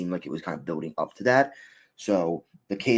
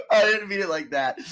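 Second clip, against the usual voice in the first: high-pitched protest through real laughter; laughing protest; 14 semitones higher, 8 dB louder than usual. Real, not acted. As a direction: deny it while cracking up. Protesting while cracking up at joke.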